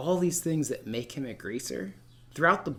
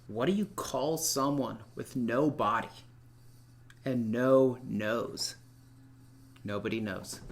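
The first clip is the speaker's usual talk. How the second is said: slow, drawn-out setup before the punchline; riddle delivery; 3 semitones lower, slower than usual. Real, not acted. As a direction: pause and drag "and no nose" then drop the answer low. Teacher tells a riddle joke.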